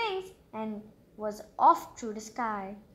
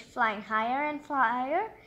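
ordinary delivery, nothing marked

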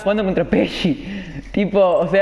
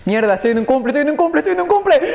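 climbing higher with each repeat; agitated repetition; 5 semitones higher than usual. Real, not acted. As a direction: build up to a high, urgent finish. Repeats it, agitated, mid-game. (repetición agitada, cada vez más aguda)

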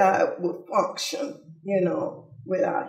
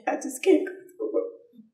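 voice giving out; 7 semitones higher, 3 dB quieter than usual. Real, not acted. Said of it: "forgive me, I can't".

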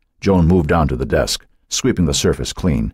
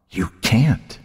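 taunting whisper; 6 semitones higher than usual. A narrator's reading. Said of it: "Cynthia whispered".

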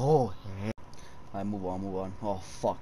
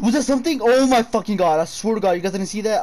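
high, loud, breathless alarm; gasp, then blurt it out in panic; frightened disbelief at what he saw; panicked gasp; 9 semitones higher, 9 dB louder than usual. Real, not acted. Sees something move in game.

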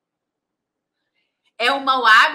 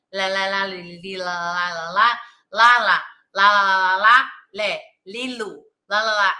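imitating wordless patient; 4 semitones lower, 3 dB louder than usual. Alzheimer's patient answers her therapist, imitated intoned babbling. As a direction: babble syllables like storytelling, lower-pitched. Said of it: "ela me contou uma história inteira assim".

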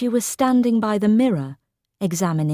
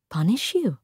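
surprised. A narrator's reading. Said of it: "She appeared surprised".

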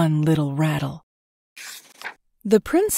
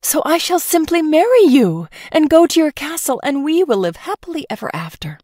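higher, breathless delight; eager gushing; 9 semitones higher, 3 dB louder than usual. Acted. Princess to the prince, eager gushing. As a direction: rush into the happy ending.

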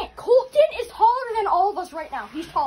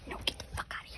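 whispering; 16 dB quieter, much faster than usual. Real, not acted. Hiding, seeker nearby.

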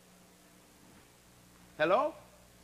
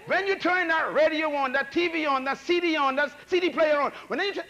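high, loud, punchy list; raised preaching voice; 6 semitones higher, 4 dB louder than usual. Real, not acted. Preacher warning the church about radio and TV, loud. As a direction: fire each item out loud.

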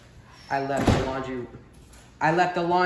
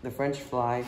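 plain reading voice

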